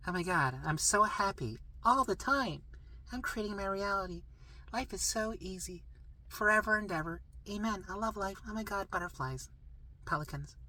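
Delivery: sing-song mock spiritual bliss; mock blissful. Shown as a demonstration. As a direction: gush dreamily like a cliché of positivity. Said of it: "No, not like that".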